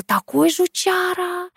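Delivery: high, slow, drawn-out relish; gleeful relish. A narrator's reading. Asya tells Katya about her father, relishing it. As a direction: stretch it out high with sly admiration.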